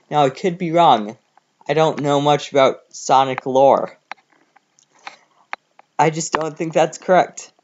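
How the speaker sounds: usual speaking voice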